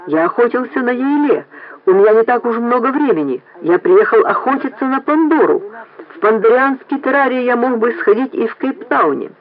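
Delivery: mildly indignant, high-pitched protest. A narrator's reading. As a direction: argue with affronted pride. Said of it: "Глаза его засверкали".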